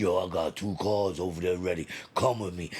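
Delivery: low voiced street friend; friend's voice. Retold friend's invitation, deep voice.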